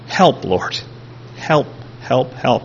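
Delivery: soft, spaced-out pleading; hushed, repeated plea, barely pushed; muted cry for help. Retold or imitated. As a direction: imitate a weak cry out to God. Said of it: "he cries out to God".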